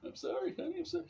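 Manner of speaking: very high pleading apology; mock cowering. Boyfriend apologising to angry girlfriend, hammed-up meekness. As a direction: squeak it out meekly.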